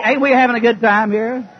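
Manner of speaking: ordinary talk, nothing marked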